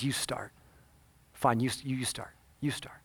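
low, near-whispered concession; soft retold mumble, handing it over; hushed reluctance. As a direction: give in quietly, haltingly. Operator reluctantly yields to prayer.

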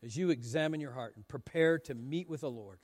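low, soft, reverent sermon close; gentle pastoral appeal. Real, not acted. Preacher inviting the church to the Lord's table, hushed and reverent. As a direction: bring the room down to hushed reflection, with long pauses. Closing appeal before communion.

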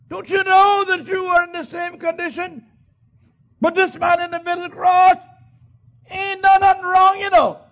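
very high, very loud scolding shout; thief shouting at his buddy. Acted. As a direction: bellow it across the crosses at the other criminal. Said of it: "he shouted to his buddy".

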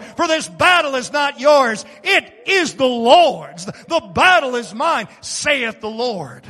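loud, rising preacher's cry; preaching. Acted. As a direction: preach it out with full force. Preaching at full force.

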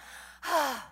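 a drawn-out, put-upon sigh; demonstrative sigh of being frazzled; exasperated sigh. Shown as a demonstration. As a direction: sigh like you're overwhelmed by chores. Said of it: "that noise that we all do".

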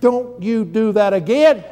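put-on high, slow little scold from a three-year-old; toddler scolding. Grandson scolds the grandfather who startled him, in a slow child voice. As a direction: play the grandson warning grandpa slowly. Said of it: "He looked at me and went".

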